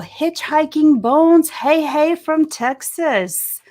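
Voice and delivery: high, drawn-out, loud hello; calling out a greeting. Real, not acted. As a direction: hail a viewer from afar, stretching the words.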